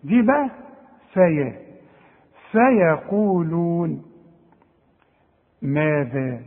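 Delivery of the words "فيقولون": فيقولون said slowly with light letters; slow recitation. Shown as a demonstration. Teacher reciting the word slowly for a student. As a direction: draw out فيقولون. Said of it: "قلتها صحيحة".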